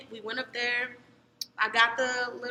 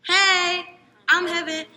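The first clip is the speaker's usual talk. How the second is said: high, loud hello; re-enacted over-keen greeting; eager introduction; 6 semitones higher, 8 dB louder, much slower than usual. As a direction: greet each new person with big projection. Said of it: "he introduced me to everybody".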